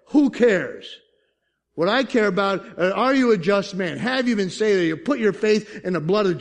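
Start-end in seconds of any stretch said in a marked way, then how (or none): 0.1-1.0 louder, higher defiant retort; emphatic dismissal. Real, not acted. Pastor answering a shocked objector, emphatically dismissive. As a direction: throw it back forcefully.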